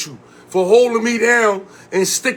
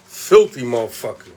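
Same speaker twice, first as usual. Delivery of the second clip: deep low growled insult; 11 semitones lower than usual. Real, not acted. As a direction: drop the voice way down and growl it. Teasing next guest.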